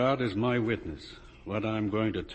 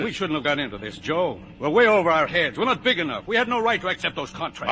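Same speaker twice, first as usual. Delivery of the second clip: higher, louder, rising alarm; agitated outburst; 7 semitones higher, 6 dB louder than usual. Acted. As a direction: blurt out the fear. Arguing with Joe.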